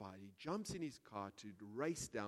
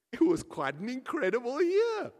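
upbeat recollection; 14 semitones higher, 16 dB louder than usual. Acted. Couple voiced looking back.

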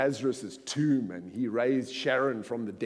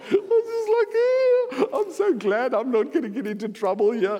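laughing; 12 semitones higher, 8 dB louder than usual. Real, not acted. Relief after mass baptism.

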